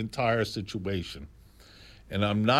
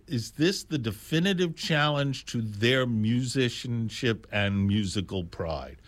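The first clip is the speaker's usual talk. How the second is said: ordinary delivery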